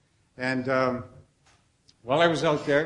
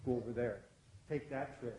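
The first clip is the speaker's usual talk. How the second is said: retold whisper over the shoulder, much softer; 3 semitones lower, 15 dB quieter than usual. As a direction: hush it like a guide leaning in close. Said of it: "whispering over my shoulder".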